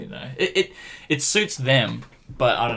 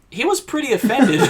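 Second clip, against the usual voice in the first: high laughing mock-report; laughing, imitating mum; 10 semitones higher, 5 dB louder than usual. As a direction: laugh through mum's words. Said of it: "Mum's like".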